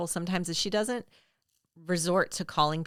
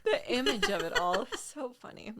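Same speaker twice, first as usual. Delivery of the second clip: high voice, helpless with real laughter; laughing; 7 semitones higher than usual. Real, not acted. Co-host still tickled by the joke's image, laughing. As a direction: talk through the giggles. Both hosts cracking up at joke.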